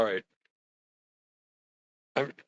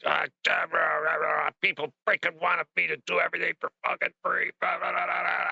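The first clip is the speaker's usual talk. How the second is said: fast, higher-pitched mock ranting; retold angry rant; 5 semitones higher than usual. Son-in-law swearing in front of his father-in-law, mock angry rant. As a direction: rattle off a grumbling tirade into gibberish. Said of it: "I was really mad".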